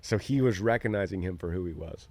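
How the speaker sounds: ordinary talk, nothing marked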